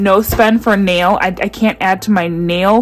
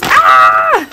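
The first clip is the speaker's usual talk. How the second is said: loud high squeal of delight; excited squeal; 5 dB louder than usual. Real, not acted. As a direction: let out a joyful shriek. Reacting to note.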